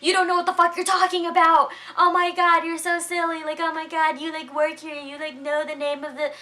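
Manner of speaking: high, fast, loud teasing rant; exaggerated airhead-style berating of a friend; mock scolding. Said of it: "and was like".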